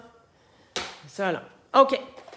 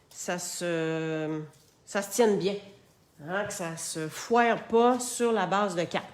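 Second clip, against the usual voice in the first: ordinary delivery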